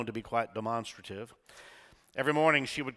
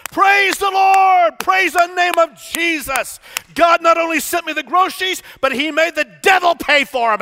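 jubilant shouting; 16 dB louder than usual. Retold or imitated. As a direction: shout for joy with hands in the air. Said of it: "clapped and shouted".

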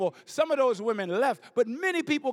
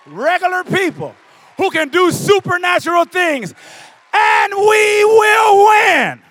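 much higher, much louder, slow triumphant roar; shouted preaching climax; 11 semitones higher, 18 dB louder, slower than usual. Acted. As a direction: bring the house down at the end of the sermon. Final shouted altar call.

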